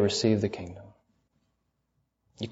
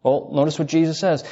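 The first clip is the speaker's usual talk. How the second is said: usual speaking voice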